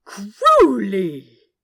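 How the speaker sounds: loud, long outraged cry of his name; scandalised cry. Acted. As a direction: shout his name, appalled. Scandalised angel.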